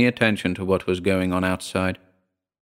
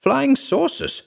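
squealing; slower than usual. Acted. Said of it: "Kitty was squealing and pointing".

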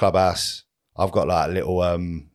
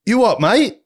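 high, louder mock "you what"; retold challenge; 14 semitones higher, 6 dB louder than usual. Mates square up to the stranger at the tee, loud mock challenge. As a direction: bristle back at him, pitched up and loud. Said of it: "We're like".

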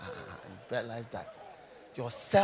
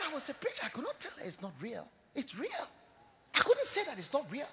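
much softer, solemn answer; hushed reply; 7 semitones higher, 10 dB quieter, faster than usual. Retold or imitated. [name] quietly answering his dying auntie.

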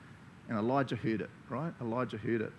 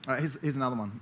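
ordinary talk, nothing marked

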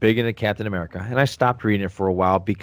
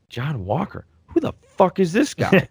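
put-on higher, stunned kid voice; outraged disbelief; 8 semitones higher than usual. Kid shocked by the new Captain America in the comic. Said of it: "and I went".

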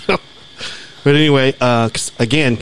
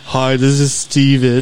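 slow, stuffy phone greeting; Steven; slower than usual. Retold or imitated. Imitating Steven answering the phone, slow and stuffy. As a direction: drag it out in a mock stuffed-up voice. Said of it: "make me sound like I'm stuffy".

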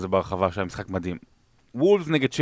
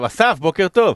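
calling out; 8 semitones higher, 5 dB louder than usual. Real, not acted. Calling out a morning greeting.

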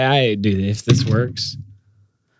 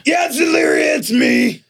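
much higher, called through the door; calling out; 12 semitones higher than usual. Acted. Fiddy calling to Zelaria from the hallway, higher. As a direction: call to her from outside.

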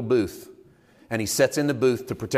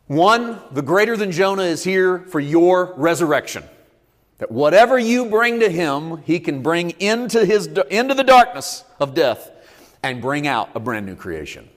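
loud, high declaration; preaching at full force; 8 semitones higher, 8 dB louder than usual. Acted. Preacher promising the congregation resurrection, full-force preaching. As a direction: proclaim it over the congregation.

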